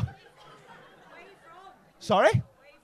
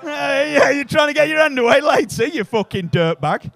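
mock accusing shout; 6 semitones higher, 12 dB louder than usual. Mock accusing audience member.

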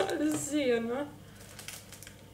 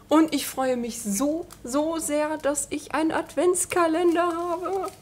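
excited; 4 semitones higher, 6 dB louder than usual. Real, not acted. Delighted about the advent calendar.

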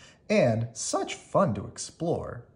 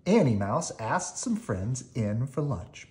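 usual speaking voice

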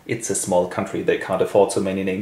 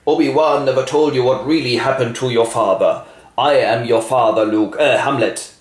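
louder Darth Vader ghost voice; ghost with a cold; 4 semitones higher, 4 dB louder than usual. Acted. The ghost tells Hamlet the truth, in a louder Vader voice. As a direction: boom it like a masked ghost with a head cold. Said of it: "seems to have caught a cold".

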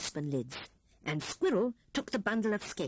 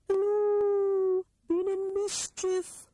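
much higher, louder, teasing owl voice, slow on the question; sly owl; 11 semitones higher, 5 dB louder, slower than usual. A narrator's reading. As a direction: tease the rat slowly like a goblin owl. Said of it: "chuckled in a goblin way".

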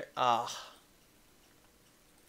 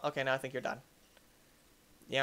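ordinary delivery

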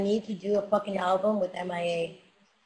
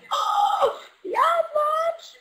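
high shout, much higher; triumphant shout; much slower than usual. Retold or imitated. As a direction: crow over your win. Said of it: "you're gonna be like".

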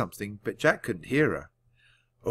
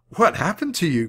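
surprised; 7 semitones higher, 4 dB louder than usual. A narrator's reading. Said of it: "cried Jack".

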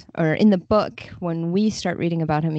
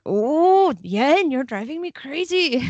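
mock exasperated captain; 8 semitones higher, slower than usual. Translator imitating the angry captain, high and exasperated. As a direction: play the fed-up captain, pitched up. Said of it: "being like".

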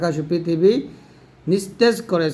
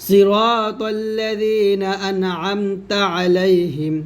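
slow, drawn-out Quranic chant; measured tajweed recitation; 2 semitones higher, much slower than usual. Shown as a demonstration. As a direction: recite it slowly, stretching the vowels. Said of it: "যেমন এখানে আছে".

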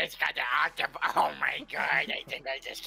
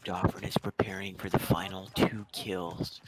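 hushed commentator whisper; 4 semitones lower, slower than usual. Pretend. Hushed commentator aside on teammate.

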